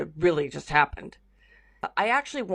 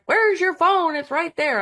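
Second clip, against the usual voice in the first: frequency fairies; 11 semitones higher, 4 dB louder than usual. Retold or imitated. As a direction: play a curious little fairy asking. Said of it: "they're like".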